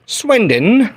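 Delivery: a playful English take on Swindon; British accent. Retold or imitated. Streamer echoing a viewer's town in British accent. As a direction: say Swindon the English way, Swin-dun, in a high put-on British voice. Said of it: "Got your accent by Ethernet".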